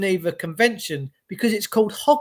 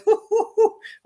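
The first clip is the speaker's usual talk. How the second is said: laughing; 13 semitones higher than usual. Retold or imitated. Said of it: "he sat at home laughing".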